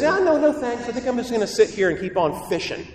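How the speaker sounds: high-pitched mock brush-off; reluctant apostle. Apostle declining Jesus to keep fishing, mock-reluctant. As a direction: play an apostle casually turning down the invitation. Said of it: "He says".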